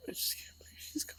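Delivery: soft, tear-choked whisper; the grief barely breathed out; broken whisper. Real, not acted. Repeats the line, now in a whisper.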